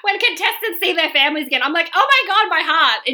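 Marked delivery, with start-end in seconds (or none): 1.9-3.0 high-pitched, heart-melting exclamation; mock emotional gush. Crier watching MasterChef commercials, high emotional gush. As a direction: gush as if moved to tears, lightly hammed up. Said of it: "I'm a huge crier".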